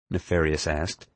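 plain reading voice

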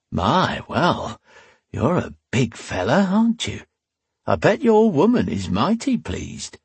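high, swooping, admiring come-on; flirty tavern woman. A narrator's reading. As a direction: size him up as a forward barmaid.

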